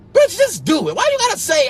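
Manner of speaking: high, loud exasperated outburst; exasperated yell. Acted. Ranting at positivity posters, high loud exasperated yell.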